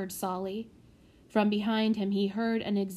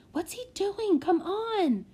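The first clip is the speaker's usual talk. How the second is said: high, tense, impatient urging; muttered through clenched teeth; 10 semitones higher than usual. A narrator's reading. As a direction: fret through gritted teeth. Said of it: "he muttered through clenched teeth".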